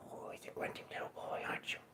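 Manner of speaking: voiceless whisper. Acted. Owner whispering to his grunting cat.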